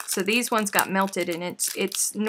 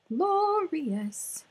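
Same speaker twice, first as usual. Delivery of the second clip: high savoring; 8 semitones higher than usual. Real, not acted.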